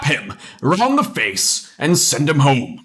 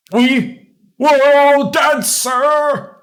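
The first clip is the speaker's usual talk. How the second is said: higher, frightened stammer of a guard; a terrified soldier barely getting the words out; quavering; 9 semitones higher, 4 dB louder, much slower than usual. Acted. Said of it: "he says in a quavering voice".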